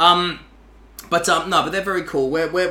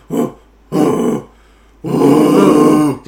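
breathy barked woofs into a scream; harsh scream bark; 9 dB louder, much slower than usual. Shown as a demonstration. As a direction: bark from the gut, loud. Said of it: "So that noise".

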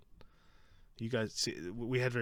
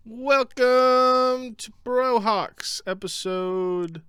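very loud, high, slow showman intro; announcer voice; 17 dB louder, much slower than usual. Acted. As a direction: stretch every word like a ring announcer.

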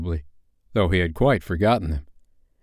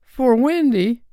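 high, slow horrified question; aghast boy, pitched very high; 4 dB louder, slower than usual. A narrator's reading. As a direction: echo it in utter disbelief. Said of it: "John said aghast".

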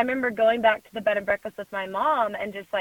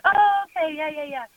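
fake recognition; 7 semitones higher than usual. Pretends to know the band.

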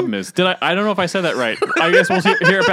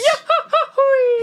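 high whooping cartoon holler; Goofy yell; 4 dB louder than usual. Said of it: "Oh, Goofy, you rascal".

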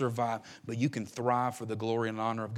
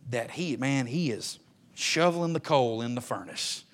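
usual speaking voice

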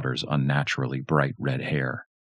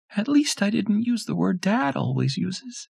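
put-on very high, cheeky child; little girl voice. A narrator's reading. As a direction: tease her dad.

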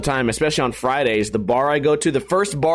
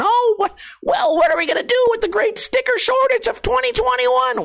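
high, put-on whiny voice of a worried fan; mock panicked fan. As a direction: squeal it like a fan in sticker panic.

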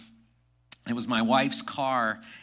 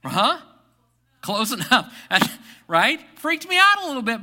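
excited; 10 semitones higher, 10 dB louder than usual. Real, not acted. Laughing after the OnStar story.

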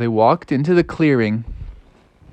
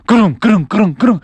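faster, higher thudding footfalls; quickening footsteps; 7 semitones higher, 4 dB louder, faster than usual. Acted. Wolf footsteps coming faster behind frogs, urgent thuds. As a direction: pound out the steps in quick succession. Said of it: "the footsteps coming faster".

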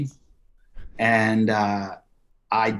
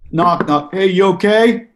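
high, loud knock-knock call through a door; mock cop checking; 8 semitones higher, 7 dB louder than usual. A policeman calling through a stuck elevator door, casual and loud. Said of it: "the guy goes like this".

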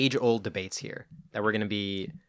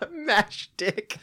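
laughing; 9 semitones higher than usual. Real, not acted. Repeating with laugh.

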